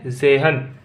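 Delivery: जेहन as German zehn, opening on a buzzed z, no t heard; silent t. Shown as a demonstration. Teacher modelling German ten for the class, जेहन as "ze-han" without t. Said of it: "तय को नहीं बोलेंगे".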